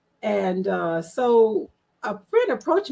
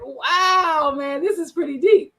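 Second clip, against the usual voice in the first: amazed; 6 semitones higher, 4 dB louder, slower than usual. Retold or imitated. First result from the prompt.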